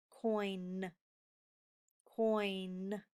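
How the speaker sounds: slow, careful coin; stretched word for sounds. Shown as a demonstration. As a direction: say coin slowly so the N shows. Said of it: "what sound does the word coin end with".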